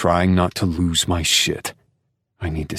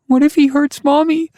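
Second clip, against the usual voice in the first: put-on high, loud child voice; a frightened little girl blurting it out; 8 dB louder than usual. A narrator's reading. Daughter tells her father, high scared child voice.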